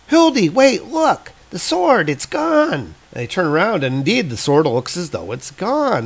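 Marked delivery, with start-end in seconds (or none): 0.1-2.7 put-on high young voice, alarmed; startled kid. Kid calling Hildi back, high alarmed child voice. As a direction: sound like a kid seeing something vanish, high and wide-eyed. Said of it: "one of the kids turns around and says".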